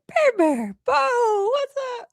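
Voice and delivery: high sing-song dog greeting; baby talk to dogs. Retold or imitated. Greeting her dogs at the door, high cooing baby talk. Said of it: "'cause I go like".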